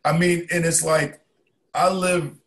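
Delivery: loud. Real, not acted. Still loud.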